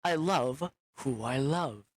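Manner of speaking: gaze into her eyes and say it slowly; mock-romantic hushed declaration; tender lovestruck vow. Looks in her eyes and goes.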